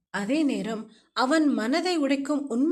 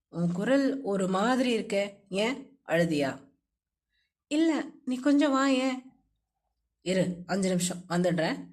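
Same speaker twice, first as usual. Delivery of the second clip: a hint of a lower, gentle young man's voice; lightly lowered friend's voice, caring; lightly voiced male friend; 5 semitones lower than usual. A narrator's reading.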